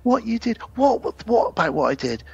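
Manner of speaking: a touch of angry disbelief; mild mock anger. Husband gets angry with his wife, mock indignant. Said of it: "he gets angry with her".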